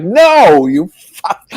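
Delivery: sudden loud, high furious shout down from the window; irate yell. Retold or imitated. Man yelling at French tourists at his door, furious and loud. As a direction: bark it at the strangers ringing the bell. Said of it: "I was, like, really irate".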